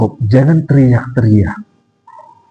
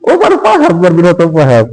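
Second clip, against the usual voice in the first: amused teasing; 7 semitones higher, faster than usual. Real, not acted. Teasing listeners who missed the point.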